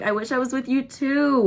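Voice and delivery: sugary high fake affection; fake sweet text. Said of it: "With four O's in the two".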